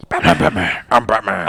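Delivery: hammed-up Batman catchphrase; Batman parody. Co-host riffing on a Batman-themed game, Batman parody.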